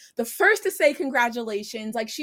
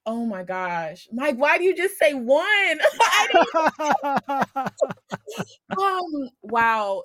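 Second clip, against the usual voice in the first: ordinary talk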